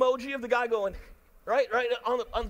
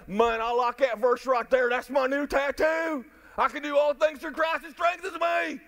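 louder, higher and hammed-up, half-sung; mock fan of a verse; 3 semitones higher, 4 dB louder than usual. Pastor mocking a believer who picks a favorite verse, hammed-up gushing. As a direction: gush over the verse like a new tattoo. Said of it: "and say".

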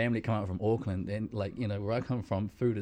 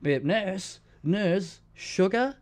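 high, loud whining for sugar; whiny patient; 8 semitones higher, 4 dB louder, much slower than usual. Retold or imitated. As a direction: nag the nurse. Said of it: "you can hear them like".

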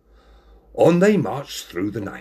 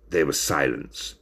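plain reading voice

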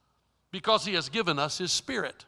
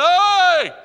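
loud, high shout at the voice; startled shout; 8 semitones higher, 10 dB louder than usual. Retold or imitated. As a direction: call out sharply into the room. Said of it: "I'm going".

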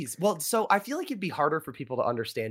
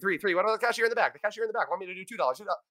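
auctioneer haggling; 5 semitones higher, faster than usual. Retold or imitated. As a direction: haggle fast like an auctioneer at McDonald's. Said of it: "you're like".

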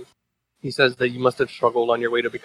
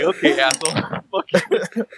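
higher, laughing mock comeback; laughing retort; 5 semitones higher than usual. Reader voicing son's reply to father, laughing. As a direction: crack up while voicing the retort.

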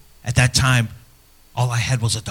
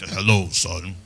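"Hello, son." lower and softer in dad's voice; lower, softer imitation of a stern dad; father's intimidating voice; 4 semitones lower, 5 dB quieter, slower than usual. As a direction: imitate a commanding father greeting his son. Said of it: "His voice was like".

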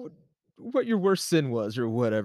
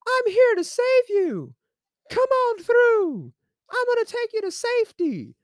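very high, drawn-out heavenly call; high-pitched mock angel proclamation; 4 dB louder than usual. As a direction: play a hammed-up angel, singing out high and slow. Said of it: "an angel comes out and says".

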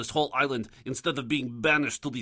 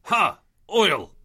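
loud, higher burst of recognition; triumphant realisation; 6 semitones higher, 6 dB louder than usual. Acted. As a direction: blurt it out like you've cracked it. Sudden understanding.

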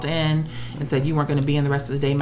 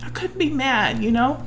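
pitched up, on the verge of tears; getting tearful; 7 semitones higher than usual. Real, not acted. Woman explaining she couldn't be angry at God, tearful. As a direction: say it through rising tears. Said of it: "I'm getting tearful about it".

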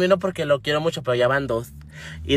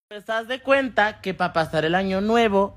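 ordinary delivery

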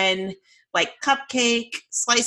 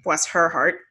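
normal talking voice

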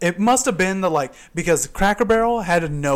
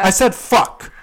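loud, raised insistence; defiant retort; 5 semitones higher, 6 dB louder, slower than usual. Retold or imitated. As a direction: state it defiantly in a retold argument. Repeating the swear word in argument.

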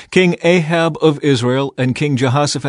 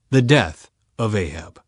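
ordinary delivery, nothing marked

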